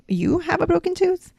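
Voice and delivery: "You have a broken tooth?" pitched high as an incredulous question; high, surprised question; questioning tune. Shown as a demonstration.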